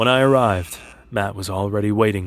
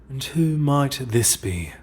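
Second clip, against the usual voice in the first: cultured, smooth; 4 semitones higher, slower than usual. A narrator's reading. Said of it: "His voice was cultured, smooth as silk".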